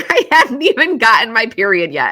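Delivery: laughing through the words; rueful laugh while telling. Real, not acted. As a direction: laugh it out in disbelief.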